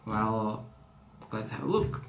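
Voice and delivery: normal talking voice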